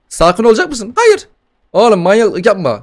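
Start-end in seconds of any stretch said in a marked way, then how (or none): 1.0-1.3 put-on much higher, louder cheeky baby threat; a tiny brat swearing; squeaky baby voice. Gamer voices the demon baby cursing him, squeaky.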